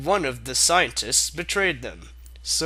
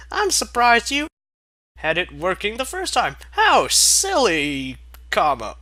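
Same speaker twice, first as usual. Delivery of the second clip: a higher, louder, eager pony voice; Twilight voice; 4 semitones higher, 8 dB louder than usual. Acted. Twilight explaining the broken controller, in a higher, louder voice. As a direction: voice the clever little pony explaining. Twilight speaking, read aloud.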